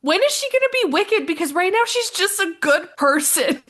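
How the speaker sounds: high, loud puzzled friend; imitating Maya. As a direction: squeak it out like a baffled newcomer. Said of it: "And, and Maya goes".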